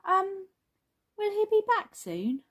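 put-on very high little-kid question; high-pitched storybook child; anxious child character. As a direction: ask hesitantly as a small child. Bing asks after Flop.

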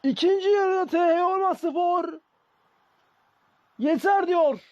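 high, loud, still-excited calling; excited commentator. Real, not acted. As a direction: keep the goal thrill going. Right after the goal.

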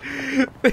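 high, helpless giggling; laugh. Real, not acted. Player at the mundane scary story, high laugh.

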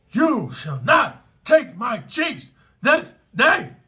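slow, high, loud war scream; Leonidas battle cry. Shown as a demonstration. Telling viewers to scream at the attacker, slow Leonidas war cry. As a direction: roar it like Leonidas at the gate. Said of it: "You scream loud like Leonidas".

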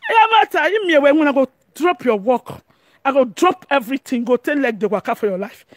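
usual speaking voice

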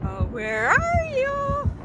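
slow, high, drawn-out taunting call; creepy sing-song stalker. Pretend.

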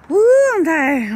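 high, loud, drawn-out woo; loud whoop. Real, not acted. Whoops out on the golf course.

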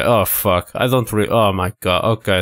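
ordinary delivery

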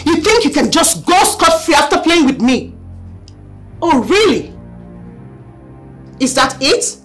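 loud, high enraged threats; furious shouting. Acted. Angry woman threatening on phone.

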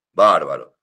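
ordinary delivery

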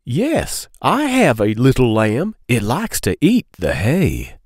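singsong picture-book read-aloud; reading to children. Acted. Storyteller reading a lamb book to kids, singsong. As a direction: lilt the question refrain for little listeners.

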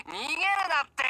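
high, loud whining; whiny plea. Acted. Boris whines at Pierce to stop fleeing. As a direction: whine at him to stop running. Boris pleading don't run.

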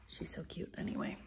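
soft whispered aside; whispered cute remark. Real, not acted. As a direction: whisper it under the breath.

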